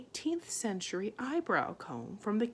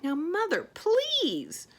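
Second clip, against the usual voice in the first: higher, pleading; begging; 11 semitones higher, 6 dB louder, slower than usual. A narrator's reading. Arietti begging her mother, higher and pleading. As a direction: beg her mother gently. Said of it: "begged Arietti".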